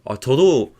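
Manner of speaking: ordinary delivery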